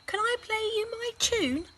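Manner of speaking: put-on high, bright child's question; eager boy. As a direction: ask hopefully like a little boy. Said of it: "asked Lester".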